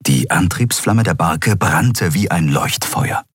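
usual speaking voice

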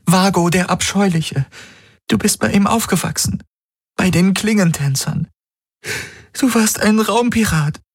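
high, rising, desperate plea; pleading young woman. Acted. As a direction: beg him to remember.

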